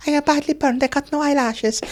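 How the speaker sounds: put-on very high, comic child squeak; hammed-up tiny child far above your range; little boy voice. Badly burned boy explains himself, in a high child voice. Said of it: "this little boy comes in".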